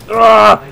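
high loud groan of disgust; frustrated loud ugh. Real, not acted. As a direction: let out a sharp fed-up ugh. Frustrated gamer after being killed.

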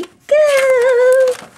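rising into a very high squeal; excited squeal. Real, not acted. Crafter finally opening her kit, excited squeal. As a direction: wind up to a high squeal as you begin.

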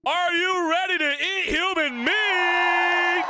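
high, slow cult-leader yell; drawn-out high shout to the followers; rallying shout. Acted. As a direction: rouse the crowd, stretching the last word. Cult leader rallies the group.

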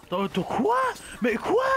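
rising outraged questions; indignant disbelief. Real, not acted. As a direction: protest in disbelief. Baffled by being targeted.

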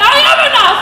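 high, loud burst of conviction; sudden realization. Retold or imitated. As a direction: burst out with new belief. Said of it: "you start off like".

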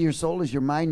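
ordinary talk, nothing marked

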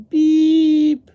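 long, very high, loud beep; tugboat horn beep. A narrator's reading. Tugboat pulling the barge, high loud horn beep. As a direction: blast a high tugboat whistle. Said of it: "says the tugboat pulling the barge".

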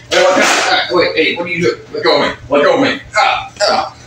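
high-pitched struggling protest; acted panic, high and yelping; alarmed protest. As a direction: protest in alarm while being manhandled. Being grabbed and dragged in skit.

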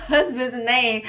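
ordinary delivery

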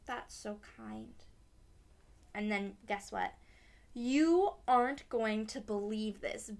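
usual speaking voice

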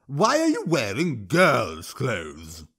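slow sneering troll voice; slow troll jeer; taunting troll. Acted.

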